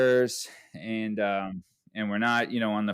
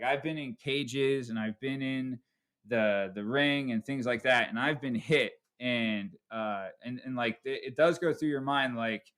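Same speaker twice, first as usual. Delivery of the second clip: ordinary delivery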